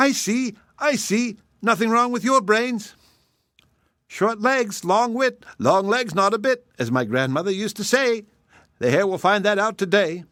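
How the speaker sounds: high, gleeful wife voice; wife approving her husband's trick; pleased wife. Acted. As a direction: savor the clever plan. Wife delighted.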